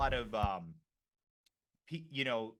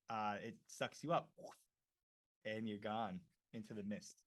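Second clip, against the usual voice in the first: normal talking voice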